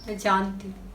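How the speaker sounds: low, soft, barely begun admission; hushed false start. Acted. Woman admits to her lover she knows, low and hushed. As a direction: let it drop low and quiet, then stop. Hesitant start of confession letter.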